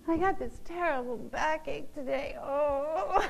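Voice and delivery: mock moaning about a backache, with a little cry; whiny complaining. Preacher mimics a churchgoer complaining, mock whine. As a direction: whine it like a self-pitying complainer. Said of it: "what I call complaining".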